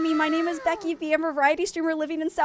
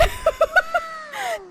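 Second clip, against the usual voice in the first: overwhelmed, high-pitched squeal of laughter; high shrieking laugh; 12 semitones higher than usual. Real, not acted. Streamer swamped by follows, shrieking with laughter.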